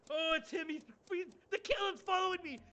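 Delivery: high-pitched, repeated cries of alarm; playful panic. Pretend. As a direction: squeal for help as the killer follows you. Being chased by killer in game.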